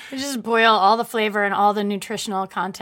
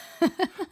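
a soft, amused giggle; brief amused laugh; light laugh; 8 semitones higher, 5 dB quieter than usual. Real, not acted. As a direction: laugh at your own joke. Laughing at her accent.

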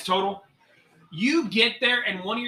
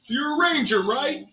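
high, pushy drill instructor, louder at the end; 7 semitones higher, 3 dB louder, slower than usual. Acted. Grim provoking the ranger student to attack. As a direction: bark the challenge and egg the student on.

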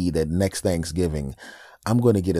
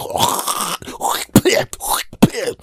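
shrill unvoiced wheeze of a laugh; squeaky sneaky giggle from siblings; high wheezing cackle; 6 dB louder than usual. Siblings spitting in food, high wheezing cackle.

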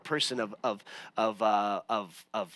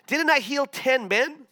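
higher, slower, pointed question; 8 semitones higher, 3 dB louder than usual. Retold or imitated. Jesus asking where the healed men went, high and slow. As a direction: lift it high and draw it out, pointed. Jesus asks about the ten.

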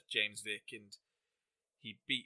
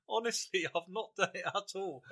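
laughing; 9 semitones higher than usual. Retold or imitated. Retold protest while laughing.

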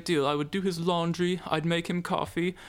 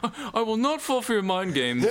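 laughing; 6 semitones higher than usual. Acted. Dickory defying captors while laughing.

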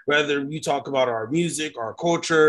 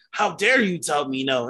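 ordinary delivery

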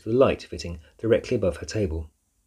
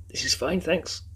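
higher-pitched, holding back a cough; trying not to cough; 9 semitones higher, slower than usual. A narrator's reading. Girl in a cloud of perfume, trying not to cough. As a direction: get the thanks out without coughing. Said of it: "trying not to cough".

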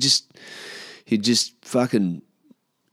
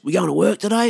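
high, loud imitation of a keen mate; acted bright-and-early enthusiasm; eager mate; 11 semitones higher, 6 dB louder than usual. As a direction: pipe up raring to go on the phone. Mate eager on morning call.

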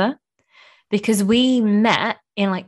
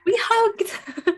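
high, tearful laugh on the words; half laughing, half crying with joy; laughing through tears; 12 semitones higher than usual. Real, not acted. To her friend, overcome recalling the hug: laughing through tears.

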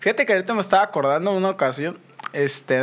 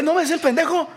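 high mocking voice of an angry passenger; ranting passenger; 12 semitones higher than usual. Mimicking the cursing bus passenger, high and mocking. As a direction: squeal it like the furious guy on the bus. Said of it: "Sí, empieza a decir".

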